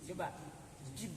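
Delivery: ordinary talk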